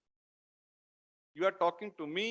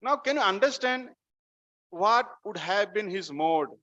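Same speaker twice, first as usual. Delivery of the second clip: ordinary talk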